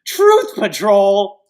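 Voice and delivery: long, loud wailing repeat of the name; drawn-out mock wail; mock outcry. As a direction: cry the name out in mock dismay, slow and loud.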